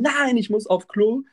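mock screaming protest. Woman insisting she needs the toilet, mock yell. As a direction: wail the refusal like a desperate passenger.

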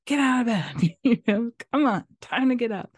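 loud, high mock wake-up call; wife rousting a sleeping husband, loud and high; not very nice wake-up call. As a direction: bark him out of bed, half laughing. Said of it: "wake him up in not very nice ways".

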